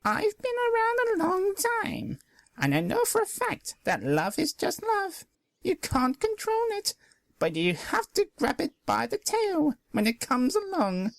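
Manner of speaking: put-on high, wise old landlady; kindly old mare giving advice.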